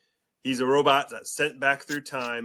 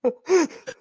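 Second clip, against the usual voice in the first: high laugh; 7 dB louder than usual. Real, not acted. High laugh after the bit.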